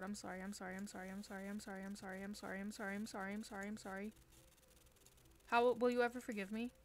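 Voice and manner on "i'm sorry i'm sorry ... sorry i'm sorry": soft, run-on string of apologies; hushed frantic apology. Real, not acted. Player apologizing to Andy for what was said, hushed and repetitive. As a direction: repeat it under your breath without a break.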